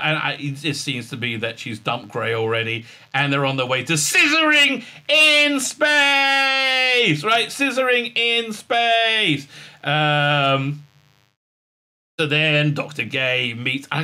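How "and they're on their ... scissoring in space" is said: slow, higher sarcastic line; drawn-out sarcastic punchline; mock grand. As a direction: stretch it out for the joke.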